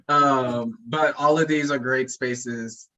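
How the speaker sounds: ordinary talk, nothing marked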